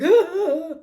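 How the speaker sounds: loud, high hammed-up sob; mock sobbing wail. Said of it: "fucking sobbing".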